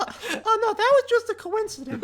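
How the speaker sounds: very high, falsely modest, laughing through it; high bashful character voice; coy high voice. Acted. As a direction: pretend it was nothing, giggling. Owl plays coy to girl.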